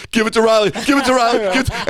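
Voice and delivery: high, loud urgent yell, repeated; screaming from the stands. Retold or imitated. Dad retells yelling at the alumni game, high excited scream. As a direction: re-enact a fan screaming for the pass. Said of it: "all I'm screaming is".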